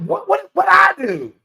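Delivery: high, bewildered "what, what, what"; shocked disbelief while retelling the clash; incredulous. Retold or imitated. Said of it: "I'm like".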